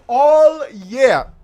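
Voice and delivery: booming, drawn-out all year; laughing, hammed-up shout of all year; loud repeat. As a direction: belt it out for comic emphasis. Mock emphatic repeat.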